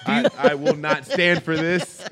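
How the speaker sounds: slow, loud, laughing mock outrage. Real, not acted. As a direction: declare it through laughter.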